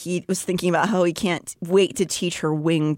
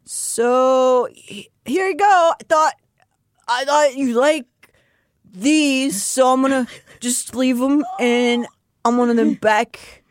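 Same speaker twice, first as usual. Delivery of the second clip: higher, louder nervous stammering suitor; flustered Cassian; 5 semitones higher, 3 dB louder than usual. Acted. Cassian dropping off books at Nesta's door, flustered and stammering. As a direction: stumble over the words like a shy lover.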